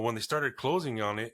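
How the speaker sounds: usual speaking voice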